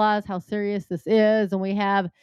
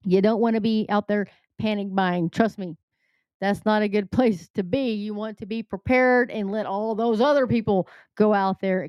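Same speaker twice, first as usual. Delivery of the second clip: ordinary delivery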